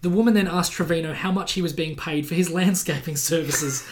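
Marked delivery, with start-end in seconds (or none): none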